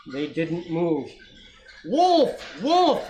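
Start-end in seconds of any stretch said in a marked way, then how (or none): 1.9-3.1 high loud alarm cry. A narrator's reading. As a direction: yell the warning to scare them. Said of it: "he cried".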